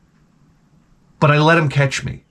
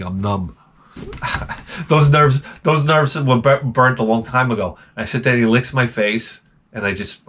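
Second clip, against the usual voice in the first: ordinary delivery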